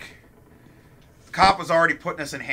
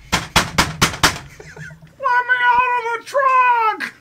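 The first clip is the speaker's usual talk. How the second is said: high, drawn-out scream to be let out; desperate yell from the trunk; slower than usual. Retold or imitated. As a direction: yell for help from inside a car trunk. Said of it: "all the fucking cop hears is this".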